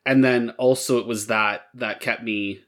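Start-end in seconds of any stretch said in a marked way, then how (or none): none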